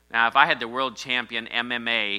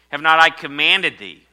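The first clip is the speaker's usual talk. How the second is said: loud, high proclamation of the verse; proclaiming; 6 semitones higher, 12 dB louder than usual. Acted. Pastor proclaiming God's command to the church, loud. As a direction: thunder the question out. Quoting scripture loudly.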